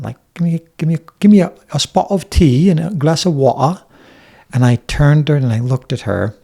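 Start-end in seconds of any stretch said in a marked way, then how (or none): 0.3-3.8 imitated English accent, pitched higher; mock English accent, raised pitch. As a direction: put on an English accent as if ordering tea. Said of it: "this English accent".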